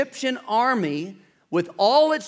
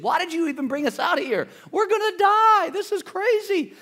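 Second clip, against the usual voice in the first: panicked complaint; 12 semitones higher than usual. Retold or imitated. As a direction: play the frightened people wailing at Moses. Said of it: "And then they said to Moses".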